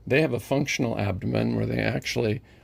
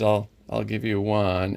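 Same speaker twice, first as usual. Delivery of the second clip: ordinary talk, nothing marked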